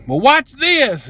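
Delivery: high, drawn-out dare; defiant challenge. Retold or imitated. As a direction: stretch it out as a taunting challenge. Retold defiant challenge to the clerk.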